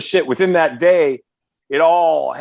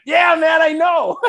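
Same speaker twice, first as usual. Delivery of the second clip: high excited shout; 13 semitones higher, 3 dB louder than usual. Retold or imitated. Singer answering [name] on the phone, high excited shout. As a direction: blurt it out high and thrilled.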